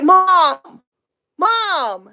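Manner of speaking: high, loud protesting son; mock protest. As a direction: whine loudly at your mother.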